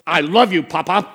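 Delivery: loud, creepy toddler imitation; Chucky doll. Grandson marching back to obey mother, mock Chucky-doll voice. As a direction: force it out like a menacing doll. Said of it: "He sounds like Chucky doll".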